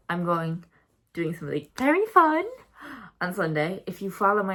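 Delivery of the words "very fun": high, loud sing-song excitement; excited squeal of anticipation; excited teasing. Real, not acted. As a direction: lift it high and loud to tease a surprise. Teasing an exciting upcoming video.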